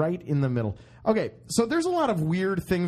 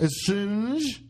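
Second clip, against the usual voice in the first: higher-pitched fake French accent; mock French accent; 5 semitones higher than usual. Translating the company name, mock French accent. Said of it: "throw that onto French".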